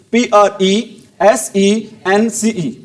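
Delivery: slow, letter-by-letter spelling; measured dictation of single letters. Shown as a demonstration. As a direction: spell it out slowly, each letter separate. Teacher spells out the word.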